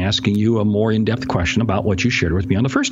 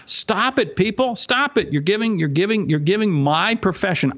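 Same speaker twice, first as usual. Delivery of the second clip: higher, pleading stop it; higher exasperated plea to careless salespeople; 9 semitones higher than usual. Real, not acted. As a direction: beg them to quit, fed up.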